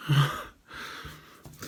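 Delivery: talking with wire between teeth; tearing with teeth. Real, not acted. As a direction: speak around a wire in your teeth. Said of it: "so I'm gonna use my teeth".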